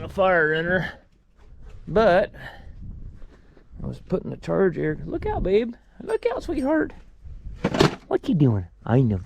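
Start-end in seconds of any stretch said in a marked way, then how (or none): 5.0-9.2 much higher, sing-song pet talk; high doting voice for a pet dog. Real, not acted. Talking sweetly to his dog.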